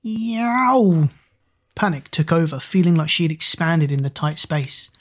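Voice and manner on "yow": high yelp like a stepped-on cat; sharp pained yowl; startled screech. A narrator's reading. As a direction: let out a sudden cat-like squeal. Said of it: "An unfamiliar screech".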